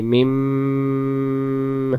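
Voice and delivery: মিম drawn out but wrongly ended; wrong mim. Shown as a demonstration. Teacher warning students against a wrong মিম. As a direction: demonstrate the incorrect মিম. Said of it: "হবে না কিন্তু".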